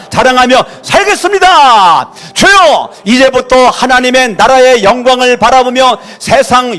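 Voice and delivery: shouted vows, high and loud; loud prayer. Real, not acted. Pastor leading the church in shouted vows. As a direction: declare each vow as a fervent cry. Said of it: "큰 소리로 시작".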